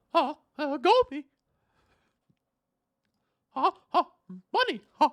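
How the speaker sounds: high cartoon mouse squeak; squeaky hammed-up cartoon mouse; Mickey Mouse. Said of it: "I can't do a very good Mickey".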